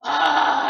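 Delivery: loud exaggerated huffing gasp; hammed-up breathy "ah"; ammonia huff. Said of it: "She huffs this ammonia and then goes".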